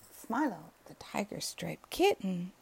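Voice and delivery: usual speaking voice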